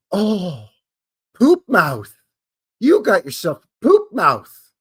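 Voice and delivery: high, loud, scandalized voice; mock prudish gasp. Scolding someone for swearing, hammed-up shock. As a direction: clutch your pearls at a curse. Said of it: "nobody's gonna be".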